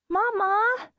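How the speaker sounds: put-on high calling child. A narrator's reading. Child crying out to mother, high and loud. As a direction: call Mama urgently, high.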